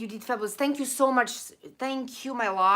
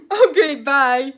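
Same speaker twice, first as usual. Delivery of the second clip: laughing; 6 semitones higher, 11 dB louder, slower than usual. Real, not acted. Laughing at her own bit.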